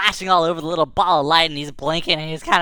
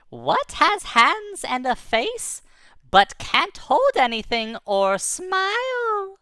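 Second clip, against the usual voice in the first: riddler voice; 9 semitones higher, much slower than usual. Acted. Ball of light asks riddle.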